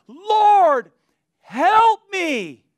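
very high, loud, long-held wail; imitated desperate cry. Woman bowed before Jesus begging help, imitated desperate cry.

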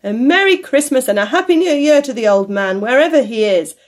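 loud festive good wishes; hearty toast. A narrator's reading. Nephew wishes his absent uncle well, hearty and loud. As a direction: proclaim it to the whole room.

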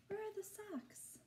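high, breathy gasp of wonder; surprised gasp. Acted. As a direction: act amazed for the little ones. Reader acts surprise to kids.

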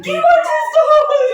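high, slow cry of shock; the words break as she cries; stricken disbelief. Acted. Mother told her elder son is dead, crying out in shock.

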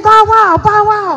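high, loud "bow wow" dog; dog barking. Acted. Hoosie the dog running in front, barking bow wow. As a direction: bark the words out like an eager dog.

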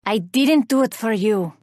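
normal talking voice

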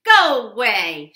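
loud slow shout; grouchy ladybug shouting go away. Acted. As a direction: bark it away. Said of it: "shouted the grouchy ladybug".

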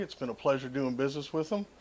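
normal talking voice

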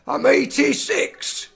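put-on old, half-deaf man's high, slow shout; high-pitched, trembly old-timer at full volume; Alfie. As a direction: bellow it out slowly as a doddery northern old man. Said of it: "this is Alfie, uh, he's got the tremors".

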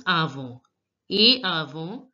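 avant as "ah-von"; et avant ending on a nasal "on"; silent final t. Shown as a demonstration. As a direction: drop the t at the end of avant. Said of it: "T is not pronounced".

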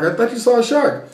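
high-pitched protest of innocence; mock defensive excuse. Husband to wife after fleeing to the boat, mock defensive. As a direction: plead your case high, hammed up.

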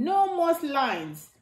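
usual speaking voice

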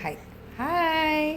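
soft, long drawn-out hi; demonstrated greeting. Shown as a demonstration. Mother shows baby how to say hi, soft and drawn out. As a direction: stretch hi slowly and quietly. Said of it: "ಹಿಂಗೆ, ಹಿಂಗೆ ಹೇಳ್ಬೇಕಾ?".

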